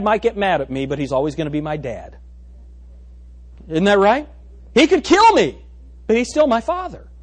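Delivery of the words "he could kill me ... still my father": sudden high, loud burst; pretend angry dad. Preacher acting out a mad father, high and loud. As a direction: throw out the dad's threat.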